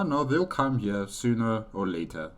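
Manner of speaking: normal talking voice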